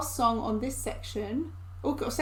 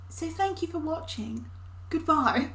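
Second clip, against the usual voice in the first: fake Queen's English; 5 semitones higher than usual. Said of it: "fake Queen's English".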